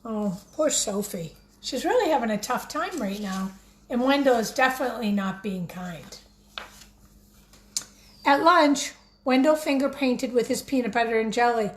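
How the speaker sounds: usual speaking voice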